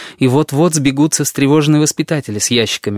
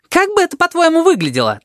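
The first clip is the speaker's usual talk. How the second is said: agitated. Acted. Tabaki argues with Sphinx.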